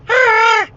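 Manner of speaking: short high cartoon "ah"; high-pitched mock squawk; falsetto shriek. Prankster breaks the silence from the closet, falsetto squawk. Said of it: "I said".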